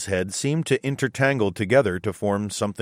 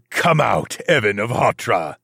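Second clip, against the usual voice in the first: louder, booming demon king call; booming demon lord; 3 dB louder than usual. Acted. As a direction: boom it out, amused demon. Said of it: "he chuckled".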